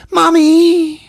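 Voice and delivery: high, loud drawn-out cry for mother; put-on high wail; mock yell. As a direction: yell it out like a climaxing boy, exaggerated. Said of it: "he yells out".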